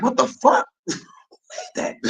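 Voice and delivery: high, startled disbelief breaking into a laugh; stunned. Retold or imitated. Stunned by a three-second ad, laughing in disbelief. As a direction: react like you can't believe what you just saw. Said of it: "I'm like".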